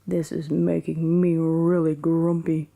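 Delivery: lightly voiced grumbling father bear; big grumpy Papa Bear. Papa Bear fed up with the mess, mildly grumbling. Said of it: "said Papa Bear in his big grumpy voice".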